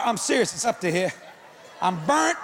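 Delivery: ordinary talk, nothing marked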